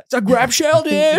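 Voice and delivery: high, drawled surfer-turtle voice; surfer turtle impression. Narrator comparing the instructor to the Nemo turtle, high surfer voice. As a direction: sound like the laid-back turtle from Nemo. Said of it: "like the turtle from Nemo".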